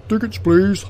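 high, loud call for tickets; train guard. Acted. Guard to passengers at the compartment door, calling out officiously.

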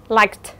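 liked ending in k plus t. Shown as a demonstration. Teacher demonstrates the ED rule, liked ending on t. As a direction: finish liked with a voiceless t. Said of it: "E D đó sẽ được phát âm là âm".

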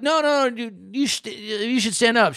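high, hurried no-no-no; flustered, pleading for her to stand; high flustered protest. Retold or imitated. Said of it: "I'm like".

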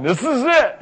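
high, mock-desperate cry; mock desperation. Moms with everything on the line, mock desperation. Voices overwrought moms before prayer.

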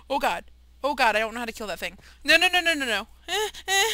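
panic, voice shooting up; panicked. Real, not acted. Panicking at a mob in game.

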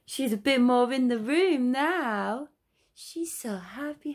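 put-on high baby talk. Mother chatting to her recovering daughter, high sing-song baby talk. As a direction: chatter brightly up high to the little one.

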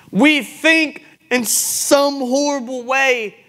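impassioned rebuke from the pulpit; full-force preaching. Real, not acted. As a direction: press the congregation hard, stretching key words.

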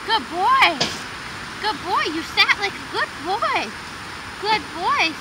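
high, sing-song dog praise. Real, not acted. Owner praising her dog for sitting, high sing-song. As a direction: gush over him like a proud owner. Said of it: "give him lots of praise".

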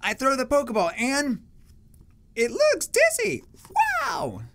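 squeaky very high cartoon voice; shrill cartoon kid; really high pitched. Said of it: "that was really high pitched".

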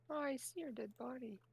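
ordinary talk

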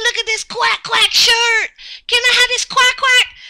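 excited shout rising into a squeal; building excitement. Pretend. Thrilled by quack quack shirt.

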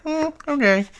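high, offhand shrug of a reply; indifferent okay. Retold or imitated. Mimicking Presley shrugging off the deal, indifferent.

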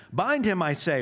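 high, loud repeated order; commanding shout. A narrator's reading. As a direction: bark the order, impatient.

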